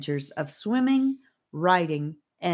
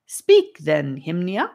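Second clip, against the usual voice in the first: theatrical; 2 semitones higher, 8 dB louder, slower than usual. Acted. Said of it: "returning to the theatrical".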